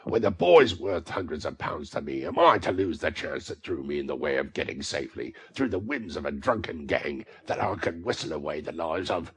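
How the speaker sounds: raging rant. A narrator's reading. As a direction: rage until you run out of breath. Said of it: "mad with rage".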